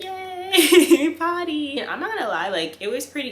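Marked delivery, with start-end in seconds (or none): 0.0-1.8 cheering praise. Retold or imitated. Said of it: "I was like".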